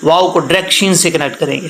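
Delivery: ordinary talk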